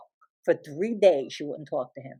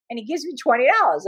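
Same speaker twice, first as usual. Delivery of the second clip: ordinary talk, nothing marked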